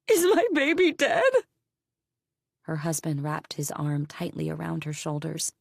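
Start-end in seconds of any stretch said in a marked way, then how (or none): 0.1-1.3 high, loud crying plea; crying wail. Acted. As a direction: cry out for the child, voice breaking. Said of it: "wailed another mom".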